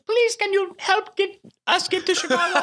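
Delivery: high falsetto mock woman with a foreign accent. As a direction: plead in a badly faked foreign accent, way up high. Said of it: "she's in a higher voice, same accent".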